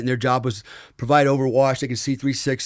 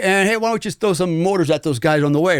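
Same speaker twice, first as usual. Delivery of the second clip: mock Taliban chatter; 7 semitones higher than usual. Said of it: "So they're like".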